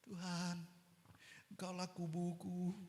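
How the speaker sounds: very soft, restrained plea to God; hushed prayer. Shown as a demonstration. Preacher showing how not to call on God, hushed. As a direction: pray it under your breath. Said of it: "Berserunya itu gimana sih?".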